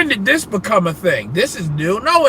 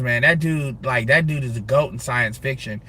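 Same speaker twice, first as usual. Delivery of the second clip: usual speaking voice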